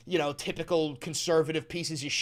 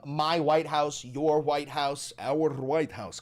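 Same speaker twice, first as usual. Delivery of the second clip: slow sarcastic list; 2 semitones lower, slower than usual. Acted.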